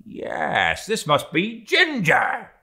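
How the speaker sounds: mate peering into the cup, scornful; Stubb, suspicious. Acted. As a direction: clinch the suspicion. Stubb's voice held.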